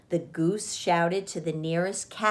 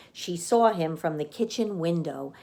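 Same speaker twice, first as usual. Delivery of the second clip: ordinary delivery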